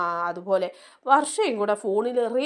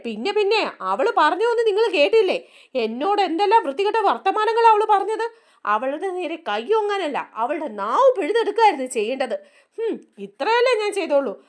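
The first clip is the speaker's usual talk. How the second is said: raised, higher, indignant scolding; angry retort; 7 semitones higher, 3 dB louder than usual. Retold or imitated. Mother Chandra defends herself to husband Ravi, indignant raised voice. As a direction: snap back at him, indignant and loud.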